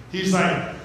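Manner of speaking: usual speaking voice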